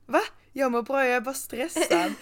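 high, loud protesting self-quote; taken-aback reply. Retold or imitated. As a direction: act out a baffled, stressed reassurance. Acting own startled reply to messages. (spelat förvånad, stressad)

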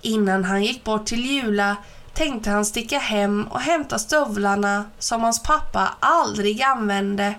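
ordinary delivery, nothing marked

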